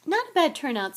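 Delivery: high, loud, pleased boast; proud. A narrator's reading. Nick surveying his party guests, proud. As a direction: show off the party with pride. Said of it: "said Nearly Headless Nick proudly".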